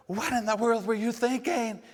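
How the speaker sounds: high, loud scolding yell; mock angry yell. Parent yelling at child over the forgotten lunch, mock furious yell. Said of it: "yelling at 'em and hollering".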